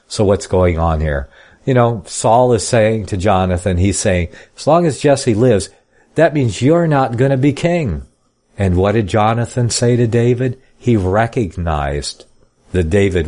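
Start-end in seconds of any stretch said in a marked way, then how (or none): 4.6-8.0 Saul's warning. Retold or imitated. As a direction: press it home like a jealous father. Voices Saul to Jonathan.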